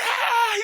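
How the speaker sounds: loud, very high comic scream; hammed-up shriek of terror; mock scream. Imitating a wrestler facing a zombie woman, mock screaming. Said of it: "He's screaming".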